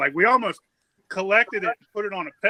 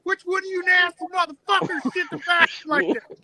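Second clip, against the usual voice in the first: higher, pitched-up outraged call over the loudspeaker; mock furious announcement; 11 semitones higher than usual. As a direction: yell it like you're ready to fight the whole bar. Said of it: "I was pissed".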